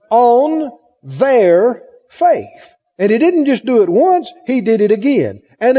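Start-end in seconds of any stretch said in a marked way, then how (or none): 0.1-2.7 very slow, each word weighed; slow emphasis. Shown as a demonstration. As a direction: drag out "On their faith". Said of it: "Where did he put the emphasis?".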